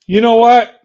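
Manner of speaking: loud, sharp challenge shouted at him; angry outburst. Real, not acted. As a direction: snap it out loud to start the attack. Angry rant at rival streamer.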